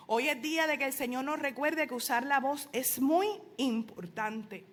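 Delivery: ordinary delivery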